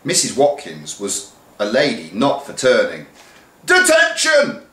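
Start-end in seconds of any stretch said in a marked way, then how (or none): 3.6-4.6 a huge, high shouted "Detention!"; shrieking teacher roar; booming. Acted. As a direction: boom "Detention!" across the hall. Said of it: "she boomed".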